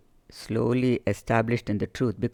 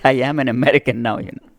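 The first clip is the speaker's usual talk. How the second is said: louder, firm comeback, half laughing; retold retort; 7 dB louder, faster than usual. Telling the satsang critic she is American now, louder and firm. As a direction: push back proudly on the critic.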